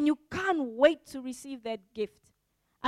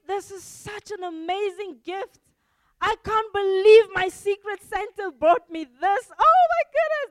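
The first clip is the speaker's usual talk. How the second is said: higher, rising into a louder squeal; delighted gift opener, growing louder; excited; 6 semitones higher, 6 dB louder than usual. Pretend. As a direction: build to a thrilled squeal. Excited receiver quoted.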